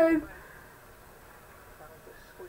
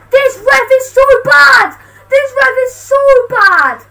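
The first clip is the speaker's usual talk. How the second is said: loud, high furious complaint; angry rant; 9 semitones higher, 9 dB louder than usual. Real, not acted. Fan furious at the referee, shouting twice. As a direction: shout it at the ref, stressing so.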